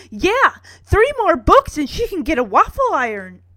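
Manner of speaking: high, loud boasting; hammed-up proud announcement. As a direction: brag excitedly, big and bouncy. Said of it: "holding three fingers up proudly".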